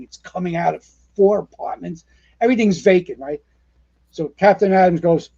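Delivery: normal talking voice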